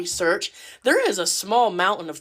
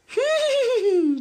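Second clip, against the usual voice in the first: shrill comic cackle, much higher; 14 semitones higher than usual. Retold or imitated. Grandma's threat done, a high wild cackle. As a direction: cackle wildly.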